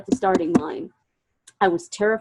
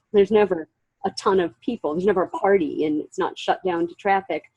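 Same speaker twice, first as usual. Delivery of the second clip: usual speaking voice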